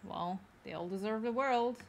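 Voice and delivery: ordinary talk, nothing marked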